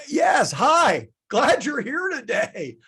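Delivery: high, loud demonstration welcome; enthusiastic greeting. Shown as a demonstration. Teacher models greeting a new student, enthusiastic welcome. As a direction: greet them with big, drawn-out delight.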